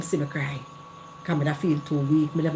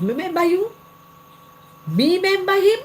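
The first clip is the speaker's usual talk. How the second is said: high, louder disbelieving question; incredulous scoff; 14 semitones higher, 6 dB louder than usual. Real, not acted. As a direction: scoff at the very idea of remembering him. Scoffing at remembering the ex.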